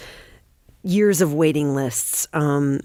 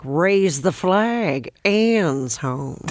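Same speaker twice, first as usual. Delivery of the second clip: slow, drawn-out grandma proclamation; 3 semitones higher, much slower than usual. Retold or imitated. Grandmother greeting Anne as she comes home, slow and grand.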